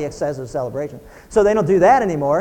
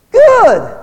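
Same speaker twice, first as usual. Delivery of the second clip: high, loud mocking good; loud mock approval; sarcastic good; 12 dB louder than usual. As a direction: snap it back dismissively. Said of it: "What do you want?".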